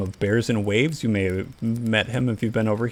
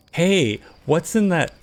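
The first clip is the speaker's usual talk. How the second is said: excited question; 6 semitones higher, slower than usual. Retold or imitated. Excited gamer quote.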